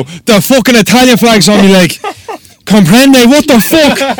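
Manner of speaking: very loud, high-pitched incredulous yelling; outraged shouting. Retold or imitated. Customer shouting at uncomprehending tattooist, furious high yell. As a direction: yell it to get through to her.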